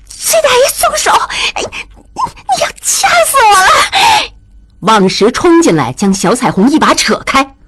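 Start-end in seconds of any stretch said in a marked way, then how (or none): 0.1-4.3 very high strangled cries; choking, gasping. A narrator's reading. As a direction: squeal for release while being throttled. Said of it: "小彩虹喘不过气来". (被掐住喉咙，尖声喘着求饶)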